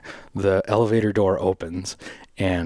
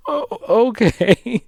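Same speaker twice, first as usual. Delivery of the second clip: very slow, much higher and louder dazed "oh, okay"; stunned okay; 6 dB louder, much slower than usual. Real, not acted. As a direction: drag it out in disbelief. Bewildered after being yelled at.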